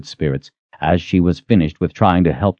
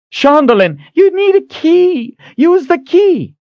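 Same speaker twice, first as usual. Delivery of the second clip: shouting; 5 dB louder, slower than usual. Acted. Shouting up amid fight.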